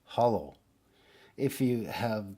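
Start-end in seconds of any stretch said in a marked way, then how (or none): 0.0-0.5 German "hallo" in an American accent, like "hollow"; American-style open first vowel. Shown as a demonstration. Language teacher showing an American saying hallo, American accent. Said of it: "he will do, do like a".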